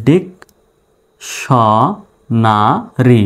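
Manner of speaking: ডিক স না রি in separate pieces, long pauses between; syllable by syllable. Shown as a demonstration. Teacher spelling out the word for learners, syllable by syllable. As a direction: break dictionary into slow, isolated syllables. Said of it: "dictionary বানান হয়ে গেল".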